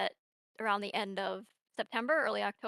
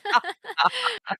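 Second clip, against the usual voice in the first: high laugh; 13 semitones higher, 9 dB louder than usual. Real, not acted. Laughing at her own cold.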